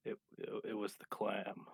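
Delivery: low, very quiet deadpan; soft, low monotone confession. Acted. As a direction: say it hushed and toneless, haltingly. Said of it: "just monotone".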